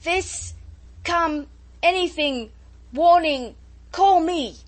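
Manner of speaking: high, loud bursts of rebuke; upbraiding shout. A narrator's reading. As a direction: scold in single shouted words through the storm. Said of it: "Jukes heard his commander upbraiding".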